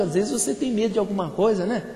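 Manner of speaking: ordinary delivery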